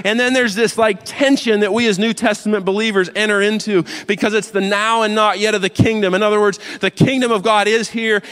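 loud, high, driving sermon voice; fiery preaching. Acted. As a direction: preach it at full force to the congregation. Preacher rising to full force.

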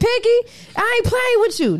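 squealing in embarrassment; high-pitched outburst. Real, not acted. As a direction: yelp it out flustered.

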